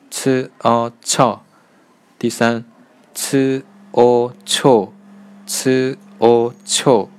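呲哦翘 spelled out slowly, sound by sound; slow syllable drill. Shown as a demonstration. Teacher spells the syllable slowly for students to copy. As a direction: drag out 呲哦翘 so each part is heard. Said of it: "第一个字呢是".

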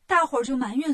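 ordinary delivery, nothing marked

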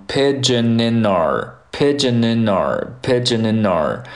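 "pigeon in R" slowly run together without breaks; slow teacher's linking of "pigeon in R"; linked phrase. Shown as a demonstration. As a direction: join "pigeon in R" into one chain. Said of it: "连在一起".

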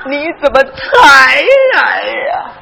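grandfather wails out his longing through tears; tearful wail. Acted. Old grandfather to long-awaited grandson, crying out.

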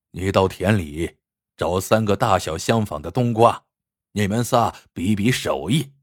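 put-on low-pitched, weary old master; ailing barber master. Ailing master instructs his apprentice. (病中师傅低沉郑重地交代)

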